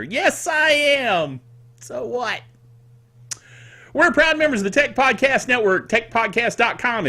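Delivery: normal talking voice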